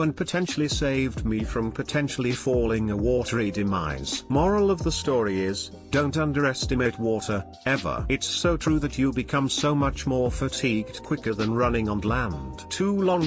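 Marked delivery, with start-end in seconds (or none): none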